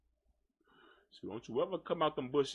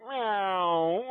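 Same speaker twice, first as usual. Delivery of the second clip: louder, rising mock meow; cat meow; 3 semitones higher, 5 dB louder than usual. Imitating the cat begging for food, mock meow.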